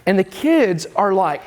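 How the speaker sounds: normal talking voice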